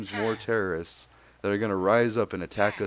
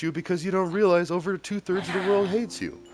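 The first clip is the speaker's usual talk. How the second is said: a hint of a cartoon kid, higher; lightly voiced cartoon boy lecturing; South Park kid; 9 semitones higher than usual. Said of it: "And he's like".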